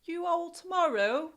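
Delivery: high, hammed-up London accent; a playful London sign-off. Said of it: "and my London accent".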